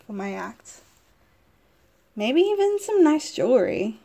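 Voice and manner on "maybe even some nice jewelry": bright, higher breathy wish; high dreamy wish. Acted.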